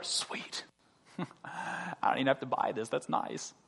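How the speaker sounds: hushed, breathy mock delight at a free gift; mock whisper. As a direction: whisper it like a sly freeloader.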